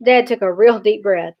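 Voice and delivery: plain reading voice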